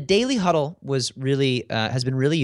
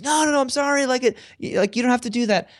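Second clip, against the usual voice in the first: much higher, apologetic copywriter voice; apologetic coworker; 13 semitones higher than usual. Retold or imitated. Leader quoting the copywriter who apologizes, higher and pleading. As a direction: imitate the copywriter rushing to take the blame. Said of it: "who wrote the email says".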